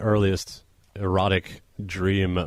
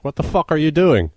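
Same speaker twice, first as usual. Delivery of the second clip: angry wife; 8 semitones higher, 7 dB louder than usual. Retold or imitated. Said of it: "my wife would be like".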